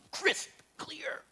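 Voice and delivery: quick breathy aside in whisper. Real, not acted. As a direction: whisper it with relish. Savoring bed-making detail.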